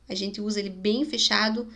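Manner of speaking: usual speaking voice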